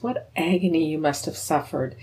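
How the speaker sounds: ordinary delivery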